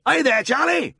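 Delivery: very high shouted question; high, loud hail to a farmhand; bawled summons. A narrator's reading. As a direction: bawl it across the yard. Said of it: "he bawled".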